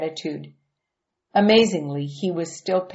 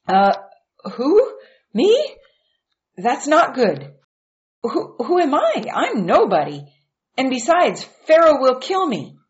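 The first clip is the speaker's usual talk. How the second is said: much higher, louder, alarmed protest; panicky Moses; 8 semitones higher, 5 dB louder than usual. A narrator's reading. Moses begging God not to send him, high and alarmed. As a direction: squeak out the objections in a fright.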